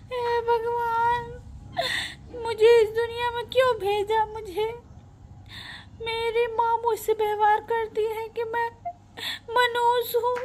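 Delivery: high-pitched tearful wail; weeping daughter lamenting to God; crying. Acted. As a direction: sob out a despairing prayer in a high voice. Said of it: "आप क्यों रो रही हैं".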